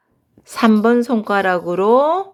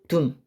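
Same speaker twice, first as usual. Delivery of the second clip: 둠 cut off short and low; staccato; 8 semitones lower, 6 dB quieter than usual. Shown as a demonstration. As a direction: clip the final 둠. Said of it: "구음을 한번 해보겠습니다".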